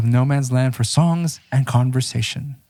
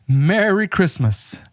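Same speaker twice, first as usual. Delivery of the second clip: cheery Merry Christmas; 6 semitones higher, slower than usual. Acted. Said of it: "Did they say it like that too?".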